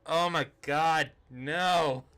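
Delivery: loud exasperated oh my God, no; mock horror. Reacting to a client's Rodman mixup, mock horrified. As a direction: recoil in disbelief.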